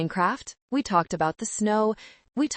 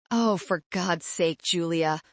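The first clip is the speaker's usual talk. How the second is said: ordinary delivery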